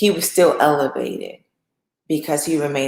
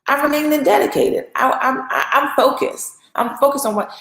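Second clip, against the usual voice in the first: higher-pitched, insistent protest; acted earnest refusal; 5 semitones higher than usual. Joseph turning down his master's wife, high protesting refusal. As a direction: stand your ground as Joseph, pitch lifted.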